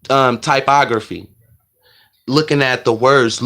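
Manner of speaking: ordinary talk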